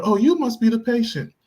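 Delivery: a hint of a kindly old lady greeting; nice old lady. Old lady welcoming the pregnant passenger, lightly voiced sweetness. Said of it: "nice old lady".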